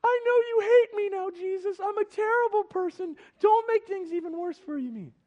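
very high, loud, hammed-up wail; overwhelmed by shame. Woman on the ground begging Jesus, hammed-up shamed wailing. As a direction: plead in a mock-desperate high voice. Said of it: "overwhelmed by the shame".

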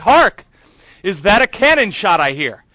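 loud, ringing theatrical delivery of the line; rehearsing a stage line. Retold or imitated. Actor rehearsing in the shower, loudly proclaiming. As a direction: belt it like a musketeer captain.

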